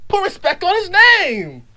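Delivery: high, emphatic cry; impassioned high-pitched insistence; indignant demand. Acted. Indignant demand to respect the rapper.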